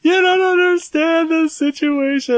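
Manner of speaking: high, slow, whining protest; mock whiny kid. Bullied boy whining to his mom, high and slow. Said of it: "I was like".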